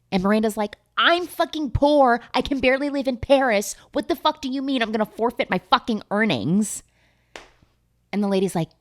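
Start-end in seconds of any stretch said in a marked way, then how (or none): 1.0-6.8 high, indignant mock protest; hammed-up indignation; mock outrage. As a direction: act out appalled disbelief, pitched up. Retold outrage at losing earnings.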